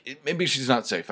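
ordinary talk, nothing marked

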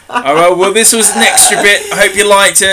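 loud. Real, not acted. Loud sign-off to listeners.

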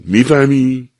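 sharp shouted question. A narrator's reading. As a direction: yell it at her down the phone. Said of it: "داد زد".

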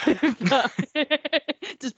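laughing. Real, not acted. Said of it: "I was really trying not to laugh then".

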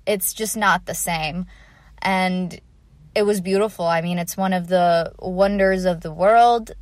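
ordinary delivery

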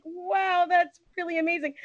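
high, hushed amazement; retold starstruck awe. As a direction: relive being dumbstruck, small soft high voice. Retold starstruck reaction to the mother.

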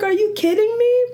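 disbelief. Retold or imitated. Hearing friend's mother died.

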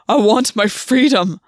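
loud, slow, desperate plea. A narrator's reading. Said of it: "his voice desperate".

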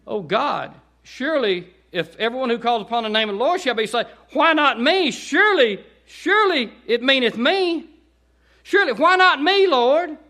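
high, loud pleading cry to God; sinner crying out. Retold or imitated. Lost person crying out at the altar, pleading. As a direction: beg God as a desperate lost soul. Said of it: "crying out, saying".

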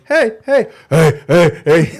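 insistent repeated calls, high at first; imitated club girl. Girl in club calling a guy, mock insistent hey. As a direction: keep calling to get his attention.

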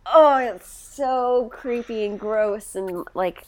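slow, stretched-out revulsion ending in "ugh"; real disgust. Real, not acted. Podcaster recoils at villain smelling her hair, disgusted. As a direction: drag out each "so", thoroughly creeped out.

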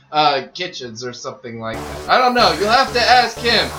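ordinary talk, nothing marked

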